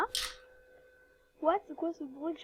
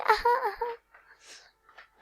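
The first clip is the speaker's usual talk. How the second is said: squeal; 9 semitones higher, much slower than usual. Real, not acted. Squeals over finished look.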